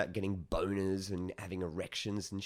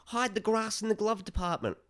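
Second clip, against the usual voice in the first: put-on panicky old woman; 11 semitones higher than usual. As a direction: squeak it out like a startled granny. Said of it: "the woman goes".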